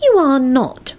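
high, stubborn contradiction. A narrator's reading. Ginny contradicting Neville, stubborn. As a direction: dig in and deny it. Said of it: "she said stubbornly".

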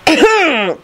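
high, insistent "ahem"; loud throat clearing. A narrator's reading. Phineas seeking Minerva's attention, insistent throat clearing. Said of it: "cleared his throat loudly".

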